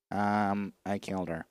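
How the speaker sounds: a hint of a creepy calm murderer; flat killer confession. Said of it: "Did he sound like that?".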